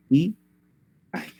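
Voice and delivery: "Y", pause, "ay" as separate syllables. Shown as a demonstration. Teacher counting syllables with students, syllable by syllable. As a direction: sound out "Y" and "ay" apart. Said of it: "la palabra tendría tres sílabas".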